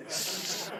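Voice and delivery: ordinary talk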